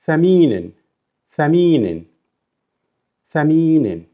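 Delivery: thaminin said clearly, long mi; model pronunciation. Shown as a demonstration. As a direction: say thaminin carefully, stretching mi. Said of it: "This is gonna be".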